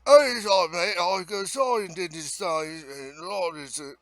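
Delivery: exaggerated Cockney mumbo-jumbo; mock Cockney. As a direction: imitate an incomprehensible Cockney Londoner. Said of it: "that Cockney British accent".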